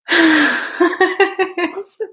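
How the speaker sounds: long high burst of laughter; helpless high giggling. Real, not acted. Laughs while signing off.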